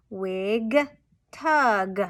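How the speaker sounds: "wig" and "tug" each with a released hard g; spaced words "wig", "tug", final g stressed; emphasized ending sounds. Shown as a demonstration. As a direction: punch the last g of "wig" and "tug". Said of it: "and emphasize those ending sounds".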